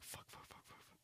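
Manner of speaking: barely audible whispered swearing; hidden cursing. Acted. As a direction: whisper curses so nobody hears. Server hiding, cursing under the table.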